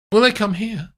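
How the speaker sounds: high, a touch anxious; high voice with mild worry; Amandine. Acted. Amandine worried.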